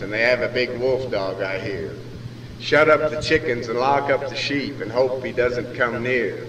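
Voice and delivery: usual speaking voice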